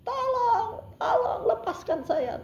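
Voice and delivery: high, drawn-out imitated wail; mock crying plea. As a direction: imitate a tearful plea to be released. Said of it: "Dia nangis". (pura-pura menangis memohon dilepaskan)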